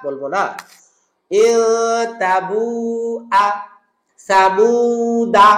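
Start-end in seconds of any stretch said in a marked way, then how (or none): 1.3-5.5 very slow ইল তাবু আ সামুদা, each syllable held; slow model recitation. Shown as a demonstration. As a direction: recite it slowly for students. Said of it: "কি বলবো?".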